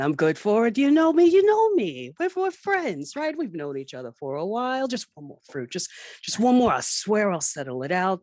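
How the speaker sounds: high, loud, frantic begging; desperate pleading. Acted. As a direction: plead with the bartender for credit. Pac-Man begging the bartender.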